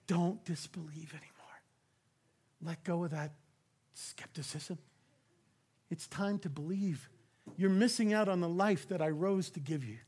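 whispered, tender invitation; soft unvoiced plea to believe; intimate whisper. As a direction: lean in and whisper it to one person. Jesus speaking to listeners.

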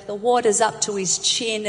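normal talking voice

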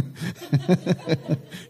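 short embarrassed laugh at his own slip; amused chuckle. Real, not acted. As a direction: laugh off the misread word.

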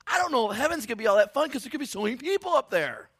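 high, shouted comic rant; hammed-up worry at the top of the voice; loud, high-pitched exasperated worrier; mock panicked complaint. Voices worrier about crowded heaven.

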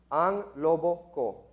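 "Ang Lobo Ko" said slowly, word by word; slow, careful repeat. Shown as a demonstration. Teaching listeners the Tagalog song title, slow and careful.

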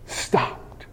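hushed whisper on one word. Shown as a demonstration. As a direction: drop to a whisper for awe. Dramatic hush on key word.

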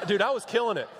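normal talking voice

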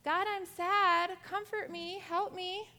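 put-on child's prayer for comfort, pleading; mock prayer. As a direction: plead gently to God. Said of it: "call out to God and say".

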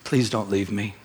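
low, subdued retold plea; understated sadness, voice dropped; tearful plea. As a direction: gently imitate a tearful wife pleading. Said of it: "crying, saying".

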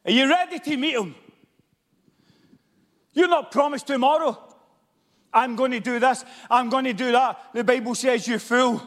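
high, loud pulpit preaching with shouted peaks; fiery revival preaching; preaching at full force. Acted. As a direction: thunder it from the pulpit. Fiery sermon passage.

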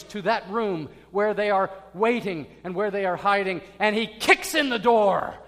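preacher retelling the soldier's raid, growing intense; building excitement. A narrator's reading. Building raid scene retold.

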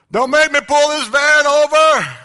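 loud, high and slow, a parent's threat from the driver's seat; furious dad threatening to stop the van; angry dad yell. Retold or imitated. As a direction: bellow it at the kids in the back seat. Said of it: "saying godly things like this".

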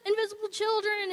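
high, bright cheering greeting; excited welcome. Retold or imitated. Crowd of forty greeting newcomer at the house, excited high welcome. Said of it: "forty people all jumping and screaming".

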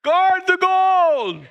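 high, loud, drawn-out coach shout; yelling coach. Retold or imitated. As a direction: holler orders across the field. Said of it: "The coach is yelling".

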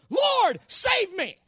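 high, short, strong cry; desperate cry for help. Acted. Peter sinking in the water and praying, desperate cry. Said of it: "Here's what Peter's prayer was".

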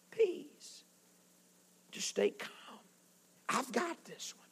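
calm the storm with a hush; soft calm whisper. Acted. Jesus calming the storm.

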